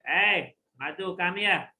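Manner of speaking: loud, stern summons to a son; angry father. Retold or imitated. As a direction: call the boy over sharply, mock-angry. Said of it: "father get angry at the son".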